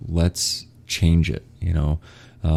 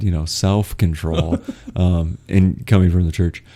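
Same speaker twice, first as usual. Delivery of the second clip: usual speaking voice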